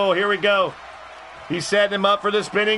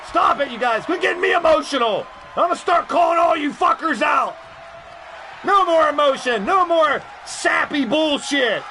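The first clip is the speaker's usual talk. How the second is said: high, shouting heel rant; Heel [name]; 8 semitones higher than usual. Pretend. Heel persona scolds chat for sentiment, high angry shout. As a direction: forbid all sentiment like an angry wrestling villain. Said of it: "Heel [name] is really trying to come out".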